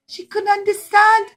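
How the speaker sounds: ordinary talk, nothing marked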